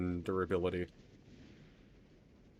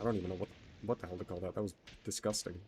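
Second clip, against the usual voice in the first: normal talking voice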